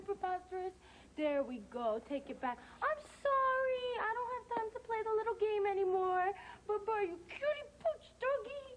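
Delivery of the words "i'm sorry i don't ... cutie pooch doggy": high, cooing goodbye; sugary baby talk. Acted. Woman leaves the little dog's game, high sweet cooing. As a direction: coo apologetically to a puppy.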